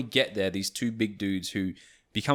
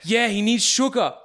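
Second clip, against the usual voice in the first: higher, louder insistent reply; urgent; 12 semitones higher, 6 dB louder than usual. Retold or imitated. Friends insisting to the bouncers, in a higher, louder insistent voice. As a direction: push the fake emergency. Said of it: "They were like".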